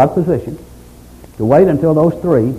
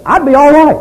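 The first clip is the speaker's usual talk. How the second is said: much higher, louder pleading cry; desperate plea; 13 semitones higher, 8 dB louder than usual. Retold or imitated. Job crying out for a referee, high pleading wail. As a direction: cry it out like a broken man begging. Preacher voicing Job's lament.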